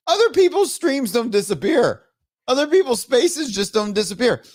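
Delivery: high-pitched frustrated protest; exasperated complaint. Real, not acted. Streamer comparing with others' working streams, exasperated.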